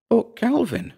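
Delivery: high-pitched woman's objection; Dora's voice. A narrator's reading. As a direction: break in with a high, protesting voice. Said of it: "said Dora".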